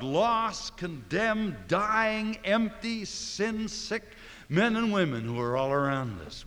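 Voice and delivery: high, loud list hammered out one word at a time; preacher pressing each word on the congregation; forceful preaching. Acted. Preacher to congregation, pleading loudly for the lost.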